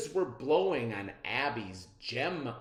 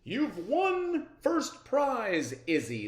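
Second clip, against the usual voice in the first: proud professor; 11 semitones higher, 8 dB louder than usual. A narrator's reading. Professor announces winner.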